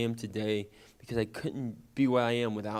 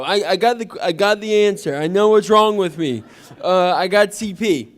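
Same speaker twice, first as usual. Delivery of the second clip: loud, proud and high; boy proudly telling the classmate his answer; triumphant announcement; 6 semitones higher, 11 dB louder than usual. Retold or imitated. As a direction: announce it like a big win. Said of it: "and I go".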